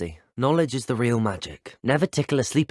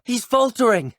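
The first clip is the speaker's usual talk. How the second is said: high-pitched loud call; battle shout; 12 semitones higher, 5 dB louder than usual. Acted. Telling allies the enemy is faltering, high shout. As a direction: call it out mid-fight. Calling out during a fight.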